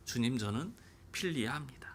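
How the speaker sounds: whispered, breathy "필리아" stretched long; hushed Peter. Shown as a demonstration. Pastor voicing Peter's timid answer in a whisper. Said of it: "다시 질문합니다".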